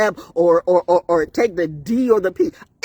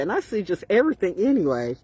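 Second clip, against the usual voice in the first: ordinary talk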